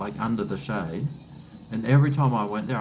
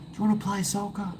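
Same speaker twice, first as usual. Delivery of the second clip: put-on higher, fast eager kid voice; kid neighbour; 9 semitones higher, faster than usual. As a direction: imitate a pestering little boy. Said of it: "he's always coming up and going".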